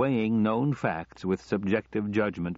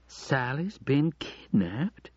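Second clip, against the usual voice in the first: slow, higher, rising question; disbelief; 6 semitones higher, much slower than usual. A narrator's reading. Wife repeating her husband's news in disbelief. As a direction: draw it out, unable to believe it. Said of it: "in disbelief".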